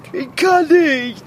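slow, whimpering refusal; scared stiff, dragging every word; whiny fear. Acted. Scared stiff on tower top.

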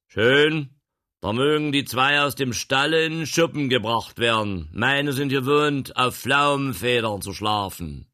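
loud, high, lordly gentleman; commanding gentleman. Acted. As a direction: boss them around from the wagon. Voices the gruff gentleman.

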